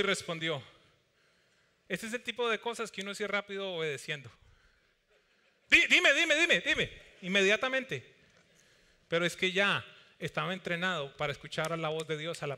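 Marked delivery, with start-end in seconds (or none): 5.7-6.9 quick, eager and louder, words tumbling; eager repeated answer. Acted. Abraham answers God at once, eager quick reply. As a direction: answer instantly, eager to obey.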